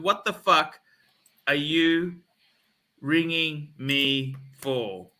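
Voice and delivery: broken up with long pauses; slow deliberate demand. Retold or imitated. Repeating his retort to the salesman, slow and emphatic. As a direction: spell it out, chunk by chunk. Same rebuke, word by word.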